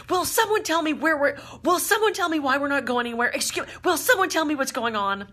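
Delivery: high, loud, indignant demands; imitating a passenger pitching a fit; mock tantrum. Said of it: "She was pitching an absolute fit".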